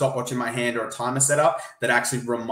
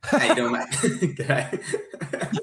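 put-on Aussie accent; 3 semitones higher, much slower than usual. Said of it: "I've gone more Aussie".

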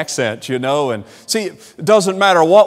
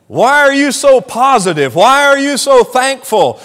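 admiring loud question; 10 semitones higher, 5 dB louder than usual. Acted. Preacher acts an admiring question.

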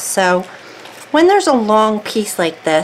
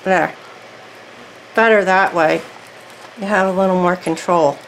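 talking with something in mouth; mouth full. Real, not acted. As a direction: speak around an object held in the mouth. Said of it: "Sorry, I had that in my, in my mouth".

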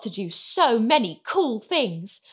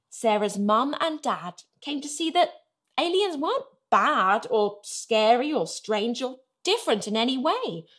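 plain reading voice

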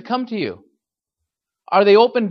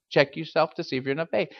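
ordinary talk, nothing marked

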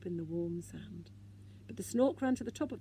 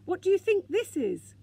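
much higher and louder, eager; excited question; 10 semitones higher, 9 dB louder than usual. A narrator's reading. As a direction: show off the find proudly. Moomin shows off his find.

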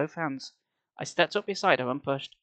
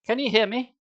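raised, higher-pitched hopeful question; eager shout; 7 semitones higher than usual. A narrator's reading. Sari calls into the dark for Dad, eagerly. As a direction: call out eagerly, rising at the end. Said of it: "Sari shouted eagerly".

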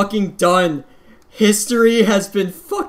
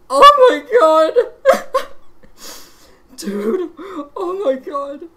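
real happy sobbing, much higher and louder; crying with joy; 5 dB louder than usual. Real, not acted. Gamer overwhelmed by his record full combo, sobbing with joy. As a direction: let the joy break your voice into sobs. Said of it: "I'm literally fucking crying right now".

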